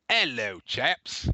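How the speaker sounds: bad put-on English accent; attempted English accent. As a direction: greet like a hammy fake Englishman. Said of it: "[name]'s attempt at an English accent".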